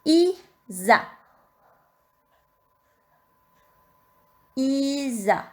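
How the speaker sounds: "Isa" drawn out sound by sound; syllable dictation. Shown as a demonstration. Teacher dictating syllables to pupils, slow and drawn out. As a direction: dictate "Isa" slowly, stretching each syllable. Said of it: "commencer la dictée de syllabes".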